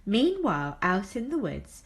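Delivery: plain reading voice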